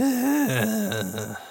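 long rasping wheeze; wheezing breath. Acted. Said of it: "long, wheezing breath as he sits down".

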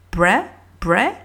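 bre, bre like the start of crêpe; French-style sound. Shown as a demonstration. Teacher sounding out bre for learners. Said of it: "donc B R".

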